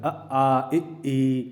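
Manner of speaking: अ, आ, इ, ई pushed out with a little force; forceful voiced vowels. Shown as a demonstration. Teacher voices अ आ इ ई with effort to show vibration. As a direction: give अ आ इ ई some push so the vocal cords buzz. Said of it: "थोड़ा सा आपको दम लग दे".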